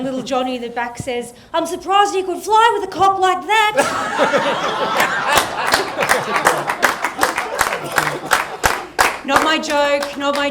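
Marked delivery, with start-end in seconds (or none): 1.5-3.7 put-on a high, loud cheeky kid. Little Johnny in the back seat, high and loud. 3.8-9.2 long, loud, breathy laughter. Real, not acted. Teller laughing loudly after his joke.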